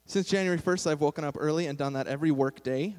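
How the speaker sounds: ordinary talk, nothing marked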